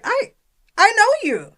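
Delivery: high, loud burst of recognition; startled recognition. Retold or imitated. Retelling to a friend how she blurted it out, high and loud. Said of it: "'cause I was like".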